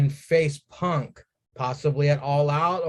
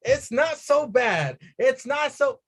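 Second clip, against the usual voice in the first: much higher, gleeful rave; overjoyed gush; 10 semitones higher than usual. Retold or imitated. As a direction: gush like it's Christmas. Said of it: "I was like".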